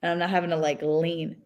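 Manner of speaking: ordinary delivery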